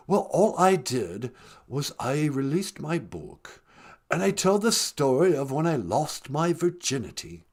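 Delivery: a hint of a glum English prince; Prince Harry impression. Harry calling the podcast, mock British lament. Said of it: "Sweetie, it's Prince Harry".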